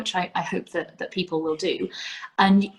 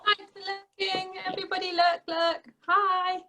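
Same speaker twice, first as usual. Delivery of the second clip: high playful voice; 11 semitones higher, 5 dB quieter than usual. Pretend. Teasing greeting, high voice.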